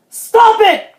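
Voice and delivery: high, loud order; exasperated parent's yell; dad crying out. A narrator's reading. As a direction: shout it to halt the mischief. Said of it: "cried Dad".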